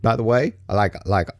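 ordinary talk, nothing marked

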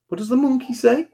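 high, rising parent question. Real, not acted. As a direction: coax an animal noise from a little one. Prompting child for animal noise.

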